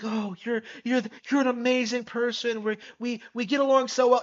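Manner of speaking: high-pitched, hammed-up infatuated gush; mock gushing. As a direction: imitate someone instantly smitten with a new person. Voices gushing new infatuation, mockingly.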